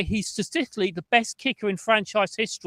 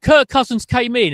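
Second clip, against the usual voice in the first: ordinary delivery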